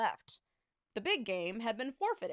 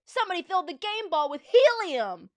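loud, high holler; high-pitched outraged fan yell; fan's shout; 7 semitones higher, 10 dB louder than usual. A narrator's reading. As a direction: yell out from the bleachers. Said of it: "shouted a fan in the bleachers".

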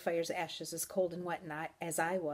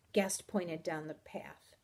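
ordinary delivery, nothing marked

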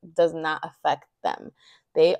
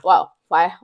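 ordinary talk, nothing marked

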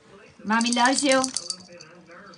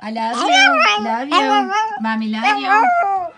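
excited; 7 semitones higher, 4 dB louder than usual. Pretend. Coaching the pet to talk.

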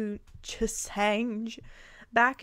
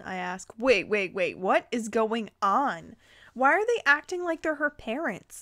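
ordinary delivery

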